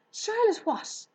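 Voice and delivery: high, loud eager pig voice; excited young pig. Acted. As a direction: gush with delight at the new friend. Wilbur's voice.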